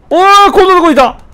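loud, high, fast excited shout; excited discovery. Real, not acted. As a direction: burst out on finding him at last. Finally finds the lost NPC. (見つけた喜びの大声)

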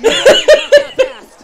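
loud laughing yell; laughing shout. Real, not acted. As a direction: blurt it out loud mid-laugh.

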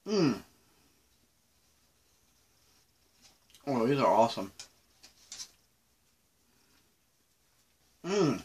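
speaking between chews; talking while chewing. Real, not acted. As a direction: mouth partly full of candy. Seen 0: Candy put in mouth at -7; jaw still working through the words.

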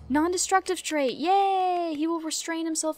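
high, loud little cheer. Real, not acted. Gamer cheering her dog's new trait, delighted. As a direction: celebrate the good trait.

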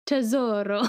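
rolled Italian Tesoro; Italian accent. Real, not acted. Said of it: "Why are you saying it like that?".

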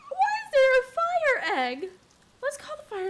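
high, loud, frantic cries; panicked shrieking. Real, not acted. Gamer panicking as fire spreads in her yard, panicked shrieking. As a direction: scream in disbelief at the fire.

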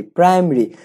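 প্রাইমারি with the middle vowel dropped; contracted "praim-ri". Shown as a demonstration. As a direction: say প্রাইমারি as two syllables, praim-ri. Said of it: "আমরা এই উচ্চারণটা ভুল করি". (প্রাইমারি, "মা" না বলে "প্রাইম-রি")

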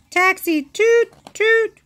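very high, loud toot toot; horn toots. A narrator's reading. Reading about the street, very high loud horn toots. Taxi noises outside.

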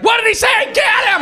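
hammed-up furious mob; mock crowd outrage. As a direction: yell it like an angry mob. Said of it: "They're like".

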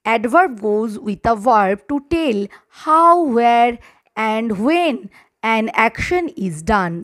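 very slow, word by word; dictating so the class can write it down; slow dictation. Shown as a demonstration. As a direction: spell it out slowly for note-taking. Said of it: "dictating a definition slowly".

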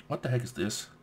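normal talking voice

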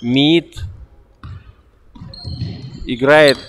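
normal talking voice

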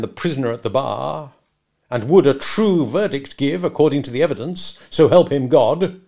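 slow, solemn courtroom oratory; declamatory, authoritative accusation; denouncing lawyer. Acted. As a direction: roll out the oath with mounting weight. Stranger denounces Wopsle to company.

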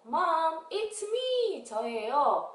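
high, drawn-out singsong; child calling Mom. Acted. Child outside calling mom, high singsong. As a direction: call sweetly so Mom knows it's you. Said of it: "친구들은".

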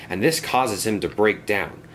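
ordinary delivery, nothing marked

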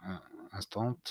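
ordinary talk

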